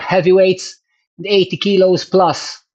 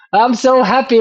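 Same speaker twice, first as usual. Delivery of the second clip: excited; 8 semitones higher, 3 dB louder than usual. Real, not acted. Thrilled at the new numbers.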